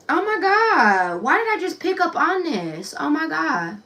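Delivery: high, loud, slowed gasp of surprise; as if she just saw something alarming; shocked. Real, not acted. Tarot reader startled by a card reading, high and loud.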